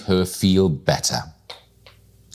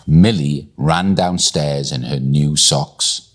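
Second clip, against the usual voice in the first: ordinary delivery, nothing marked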